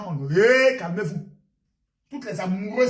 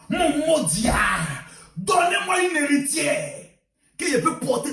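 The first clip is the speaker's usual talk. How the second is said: louder, higher, slow imploring cry; hammed-up shouted prayer; loud plea; 3 semitones higher, 5 dB louder, slower than usual. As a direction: beg the heavens out loud for an heiress. Voiced woman begging for heiress.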